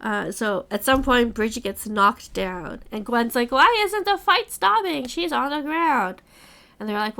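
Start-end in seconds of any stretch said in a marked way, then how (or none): 3.5-6.2 high-pitched, louder outcry of disbelief; alarmed protest. Retold or imitated. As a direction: voice jumps high in alarm at the fight. Gwen protests the unfair fight.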